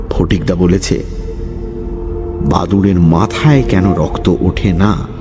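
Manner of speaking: ordinary delivery, nothing marked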